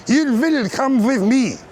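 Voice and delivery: put-on high, loud, slow German-accented order; mock German command. Brit imagines German chatting up a girl, slow German command. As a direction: bark it like a cartoon German officer. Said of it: "German nightclub".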